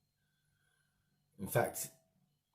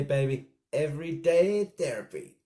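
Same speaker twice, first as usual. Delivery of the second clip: higher, louder mock American hype voice; playful put-on American accent, hell yeah; hammed-up American accent; 8 semitones higher, 10 dB louder than usual. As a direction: sign off like a pumped-up American vlogger. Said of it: "I don't wanna do the American accent".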